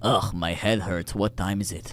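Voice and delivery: low, quiet groggy voice; groggy waking worker. Acted. Worker waking up at work, low and groggy. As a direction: drop low, just waking with a headache.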